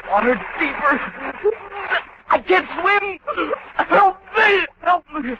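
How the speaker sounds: high, panicked pleas; terrified drowning man begging; desperate cry for help. Acted. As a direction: call out as the water rises.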